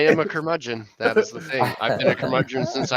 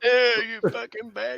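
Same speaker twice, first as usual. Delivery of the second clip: put-on grumpy old man; 10 semitones higher than usual. Old man yelling at cloud.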